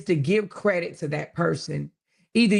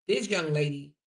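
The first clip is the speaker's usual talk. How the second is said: ordinary talk, nothing marked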